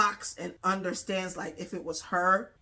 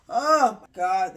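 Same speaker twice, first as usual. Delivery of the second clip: excited; 2 semitones higher, 10 dB louder, slower than usual. Real, not acted. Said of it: "speaker reacts".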